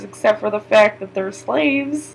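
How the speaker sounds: usual speaking voice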